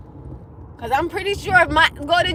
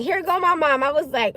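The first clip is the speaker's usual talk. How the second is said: usual speaking voice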